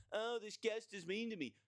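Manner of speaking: high, whiny mock of a crybaby; mocking whiny coworker. Coworker whining about a guest, high mocking whine. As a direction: whine it up high to make fun.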